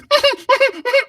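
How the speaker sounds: high choked-off yelp; muffled pained whimper; stifled cry. Acted. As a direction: swallow the scream. Tommy's cry cut short.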